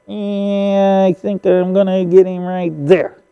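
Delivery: strained, held-tight drawl; mock painstaking aiming. Hunter dithers over his aim, mock painstaking aiming. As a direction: draw it out as if lining up a shot.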